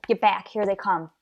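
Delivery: usual speaking voice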